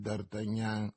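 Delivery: low, hushed and grim; gloomy. A narrator's reading. Grave musketeer to his young friend, gloomy. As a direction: sombre warning, kept low. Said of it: "soggiunse con una voce così tetra".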